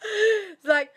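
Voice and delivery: high, loud burst of laughter; laughing hard. Real, not acted. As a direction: crack up at the thought. Laughing at herself.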